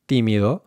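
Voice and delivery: tímido with tongue on the teeth; unaspirated t in tímido; dental, puffless tímido; Spanish t. Shown as a demonstration. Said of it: "Lastly, the T".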